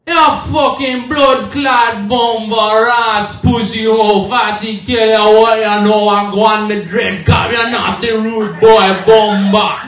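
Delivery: slow, loud Jamaican patois rant; loud, slow mock Jamaican cuss-out. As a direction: roll out the Jamaican curses like word jazz. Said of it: "when you get cussed out by a Jamaican".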